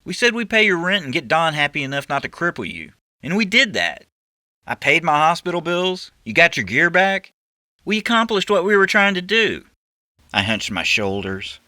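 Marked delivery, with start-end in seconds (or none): 0.0-9.6 lightly raised, insistent partner; arguing back. Acted. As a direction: push back on the plan. Narrator argues his case.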